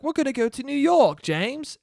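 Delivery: parent voice. Retold or imitated. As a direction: play the doting parent with a lifted voice. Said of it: "his parents are like".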